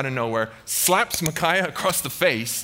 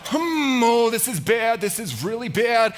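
high, hammed-up wounded whine; mock agony, pitched way up; pained dismay; 7 semitones higher than usual. Ahab pierced by an arrow, high pained dismay.

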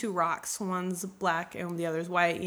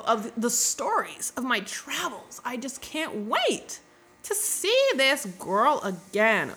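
hammed-up lovesick longing, slow and higher; lovestruck simping; 4 semitones higher, 3 dB louder, slower than usual. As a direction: swoon it out, stretching the words in mock yearning. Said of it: "he's totally simping out".

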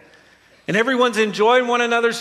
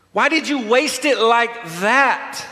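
raised, indignant acted reproach; scolding; 5 semitones higher than usual. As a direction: demand an explanation, scandalized. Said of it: "it says they scolded her".

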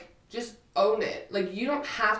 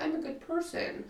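soft, higher, falsely innocent voice; mock goody-goody; 5 semitones higher, 7 dB quieter than usual. People hiding behind being nice, mock goody-goody. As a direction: mimic someone sweetly pleading innocence.

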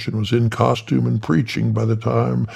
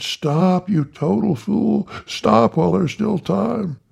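high mock scream at her husband; wife screaming at her husband, high; 11 semitones higher, slower than usual. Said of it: "she screamed".

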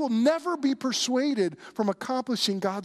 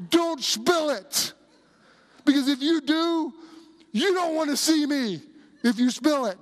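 stumbling passenger; 7 semitones higher, 3 dB louder than usual. Retold or imitated. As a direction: warn sternly as a tipsy stranger. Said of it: "like, stumbling, and she goes".

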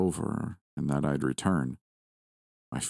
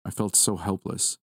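plain reading voice

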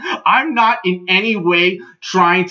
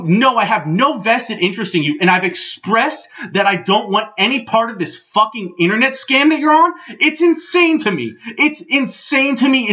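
exasperated, pitch rising toward outrage; incredulous rant; 3 semitones higher than usual. Retold or imitated. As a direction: argue in mounting disbelief. Acted angry rant at the promoter.

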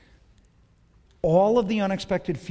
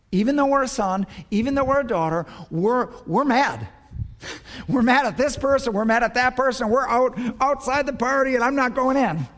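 higher, louder preaching cry; preaching at full force; 9 semitones higher, 6 dB louder than usual. Acted. Pastor to congregation, shouting about anger outside grace. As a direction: drive it home to the back row.

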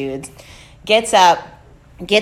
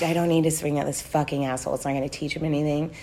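retold self-calming; 5 semitones lower, 8 dB quieter, faster than usual.

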